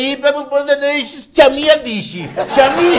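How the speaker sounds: high, loud put-on voice; mock high voice. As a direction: squeal out the bad news. Friend tells him bad news. (ψεύτικη ψηλή φωνή)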